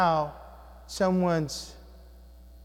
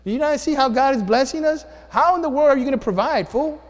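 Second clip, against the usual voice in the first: higher, louder mock-outraged scolding; imagined wife scolding; 7 semitones higher, 3 dB louder than usual. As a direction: play the exasperated wife, hammed up. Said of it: "You know, she didn't say that".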